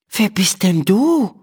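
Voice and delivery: eyes wide at the tiny man; astonished. A narrator's reading. Emma meets the gnome doctor, astonished. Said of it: "fragt Emma erstaunt".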